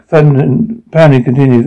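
ordinary delivery

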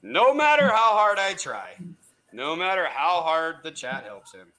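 slow, grand showman introduction; ringmaster. Acted. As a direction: roll out the big welcome. Host introducing champion.

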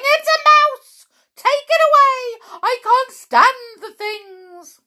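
very high, loud shrieking; panicked yelling. A narrator's reading. Mrs Jenkins springs from her chair at a mouse, yelling.

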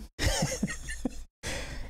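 airy wheezing laughter, high; high breathy laugh. Real, not acted. Laughing at his own speeding joke, breathless.

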